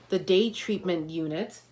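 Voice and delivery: usual speaking voice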